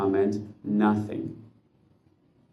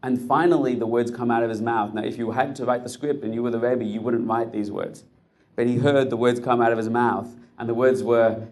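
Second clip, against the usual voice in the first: ordinary talk, nothing marked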